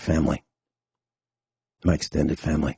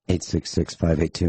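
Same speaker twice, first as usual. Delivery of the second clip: usual speaking voice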